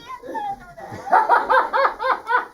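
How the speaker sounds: high, loud staccato ha-has; long acted cackle at the scolders; prankster's drawn-out laughter; mocking laugh. Kofi laughs at upset villagers.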